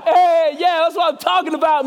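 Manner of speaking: loud, high crowd whoop; cheering. Acted. Moviegoers turning round to applaud, high rousing shout. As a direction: imitate a rowdy clapping audience.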